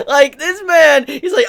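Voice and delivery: cracking up about the villain's disguise; laughing. Real, not acted. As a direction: burst out laughing mid-sentence. Cracking up over Caesar.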